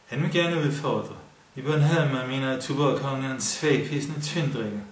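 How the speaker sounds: put-on Bornholm accent. A narrator's reading. Kurt taunts the Jutlanders in Bornholm accent. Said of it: "den bornholmske accent".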